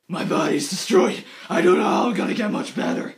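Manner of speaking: low, raspy put-on growl; hammed-up whispery growl; gravelly Batman voice. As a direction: rasp it out like movie Batman. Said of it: "Bruce Wayne is like".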